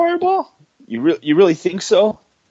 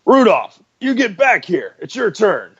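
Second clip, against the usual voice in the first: calling out; 7 semitones higher than usual. Acted. Coach calls out loudly.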